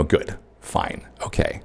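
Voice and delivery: soft, breathy throwaway answer; quiet stock reply. Retold or imitated. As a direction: toss off the answer under your breath. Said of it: "which is, you know".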